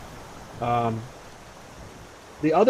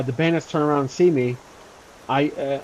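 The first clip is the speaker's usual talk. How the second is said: ordinary delivery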